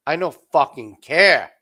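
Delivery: loud defiant reply. Retold or imitated. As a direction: throw it back at him hard. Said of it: "you go".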